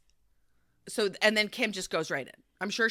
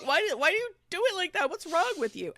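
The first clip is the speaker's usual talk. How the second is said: high, tearful pleading; tearful whine; 12 semitones higher, 6 dB louder than usual. Retold or imitated. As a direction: plead through mock tears. Kyle crying, retold.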